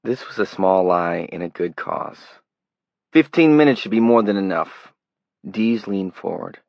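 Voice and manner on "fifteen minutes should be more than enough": louder, higher Brooklyn city greeting; a city stranger greets Johnny, loud Brooklyn accent; flat Brooklyn accent. A narrator's reading. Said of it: "He had a flat city accent as well".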